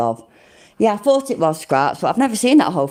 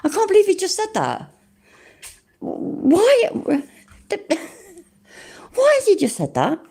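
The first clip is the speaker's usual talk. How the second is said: shocked disbelief; 12 semitones higher than usual. Real, not acted. Shocked by streamer's claim.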